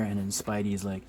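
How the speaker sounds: ordinary talk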